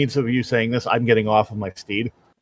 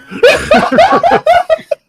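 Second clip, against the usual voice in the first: a squeaky high laugh; high giggle; 6 dB louder than usual. Real, not acted. Player laughing at the knight's defeat. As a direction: burst into a giggle.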